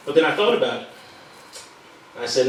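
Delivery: ordinary delivery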